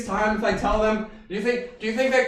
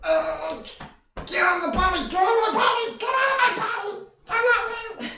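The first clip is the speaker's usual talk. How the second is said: panicked cry; 13 semitones higher, 3 dB quieter than usual. Acted. Panicked at unwanted shifting.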